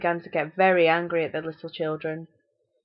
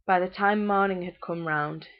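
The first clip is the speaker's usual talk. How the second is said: usual speaking voice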